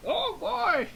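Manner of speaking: put-on very high, excited old pervert; hammed-up cartoon lech in a high strained voice; Master Roshi. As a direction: squeal it with lecherous glee. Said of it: "That's a good Master Roshi impression".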